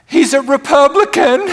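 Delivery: high, loud, wailing mock lament; hammed-up distraught churchgoer; mock tearful confession. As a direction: wail it like a tragic secret. Woman's punchline confession, mimicked.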